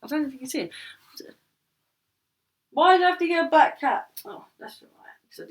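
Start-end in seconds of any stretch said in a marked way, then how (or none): 2.7-4.1 loud exasperated complaint. Real, not acted. Complaining the cat won't show. 4.3-5.5 a breathy whispered aside. Real, not acted. Whispering to herself after fussing over the cat.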